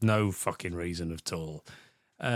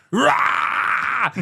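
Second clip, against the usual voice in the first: high, loud roaring shout; over-the-top war cry; mock battle roar; 7 dB louder than usual. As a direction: roar it out like an action hero spraying fire. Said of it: "and then shouts".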